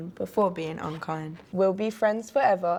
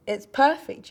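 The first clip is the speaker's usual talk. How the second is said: higher, louder delighted exclamation; exclaiming; 6 semitones higher, 5 dB louder than usual. A narrator's reading. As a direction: exclaim it like a storybook wizard. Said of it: "the wizard exclaimed".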